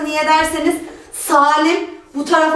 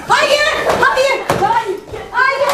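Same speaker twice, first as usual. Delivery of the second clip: shouting no; 8 semitones higher, much slower than usual. Real, not acted. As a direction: yell no as it's snatched. Losing the pillow in the scramble. (bağırarak itiraz)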